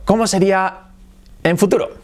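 ordinary delivery

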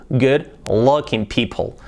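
good looking people slow, good with a short oo as in book; slow model. Shown as a demonstration. Teacher repeats good looking people slowly. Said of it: "Que no sea good".

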